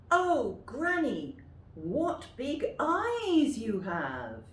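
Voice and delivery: high, slow and loud. Shown as a demonstration. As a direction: call out like an amazed little girl. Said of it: "practice them yourself".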